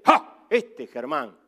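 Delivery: a sudden loud ha, then a knowing low tease; a good father catching his son in the act; fond scolding exclamation. Retold or imitated.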